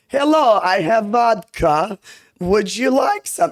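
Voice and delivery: put-on high, slow, clumsy Russian accent; bad Russian accent. Showing her early videos, in a bad Russian accent. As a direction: offer the vodka in a shaky Russian accent. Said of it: "If you go back to my first videos".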